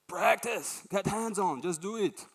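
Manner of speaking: high, punchy urging; rally the audience into action; rallying call. Real, not acted.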